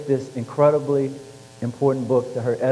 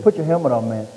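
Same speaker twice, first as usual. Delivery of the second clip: lightly voiced coach order, a bit raised. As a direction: repeat the coach's order, pushing harder.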